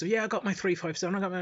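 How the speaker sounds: high, fast mock brag; mocking gun bragger. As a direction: lampoon the gun nut, quickly. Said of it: "all these guys".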